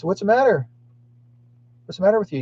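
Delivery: high-pitched questioning; retold concern. Neighbor asks the crying woman, high retold concern.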